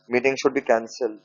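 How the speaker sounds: slow, soft dictation voice; dictating word by word to students. Acted. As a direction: spell it out slowly for the class to write down.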